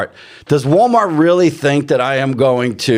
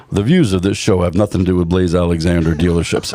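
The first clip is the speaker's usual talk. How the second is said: disclaimer read; 9 semitones lower than usual. Acted. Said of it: "I have to probably say this".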